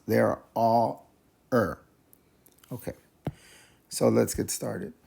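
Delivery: ordinary delivery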